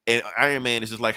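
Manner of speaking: ordinary delivery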